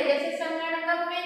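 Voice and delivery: ordinary talk, nothing marked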